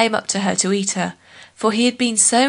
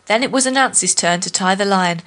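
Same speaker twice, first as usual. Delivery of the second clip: usual speaking voice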